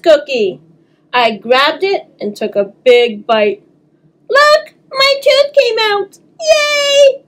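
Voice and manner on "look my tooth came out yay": high, slow squeal of delight; excited cheer. Acted. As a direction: cheer like a thrilled little girl. Child cheering lost tooth.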